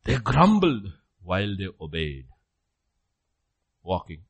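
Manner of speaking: ordinary talk, nothing marked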